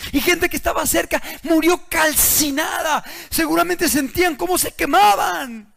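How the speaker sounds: full-force sermon voice; fiery preaching. Acted. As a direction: drive the warning home from the pulpit.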